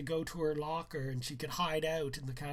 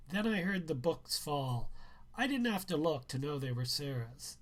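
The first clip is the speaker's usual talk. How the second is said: ordinary delivery, nothing marked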